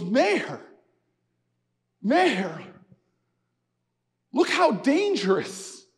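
slow, measured old man, imitated; elderly man's reply. Elderly man to the mayor in the storm, slow earnest reply. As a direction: answer the mayor slowly, letting each phrase sink in. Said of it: "He looks at me and he goes".

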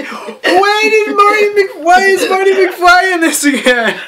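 can barely get it out; laughing. Real, not acted. Breaking down laughing.

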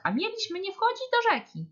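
high-pitched worried exclamation; dismayed. A narrator's reading. Hare seeing the bear soaked, dismayed. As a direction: fret in a high story voice.

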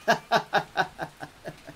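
loud mocking burst of laughter; scornful guffaw. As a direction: laugh it off with contempt. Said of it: "soltó una carcajada". (carcajada burlona)

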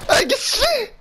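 very high alarmed squeal; shocked squeal. Real, not acted. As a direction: yelp it in alarm.

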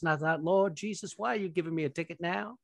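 Southern preacher accent, mock earnest; lightly hammed Southern televangelist; Joel Osteen. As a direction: answer humbly in a put-on Southern accent. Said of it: "That's a really good accent".

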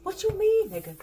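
loud, high mock outrage; mock outburst. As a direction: blast the question like an offended ex. Mock confrontation by angry partner.

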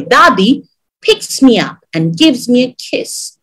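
ordinary delivery

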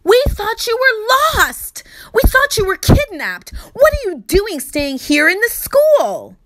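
high, loud frantic yell; a father yelling at his lost daughter. Acted. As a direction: shout it in relief and panic. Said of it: "He yelled".

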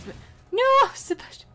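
very high, loud cry; panicked shout. Real, not acted. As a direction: yelp in alarm. Character falling.

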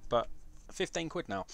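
ordinary talk, nothing marked

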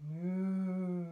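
mooing like a cow; low drawn-out moo; cow moo imitation. Shown as a demonstration. Demonstrating cow sound.

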